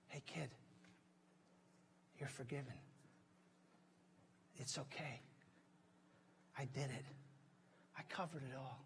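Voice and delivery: forgiving Dad. Acted. Preacher voicing Dad forgiving his kid, soft and reassuring. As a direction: reassure your child gently, with long pauses. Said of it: "What are Dad's words to you today?".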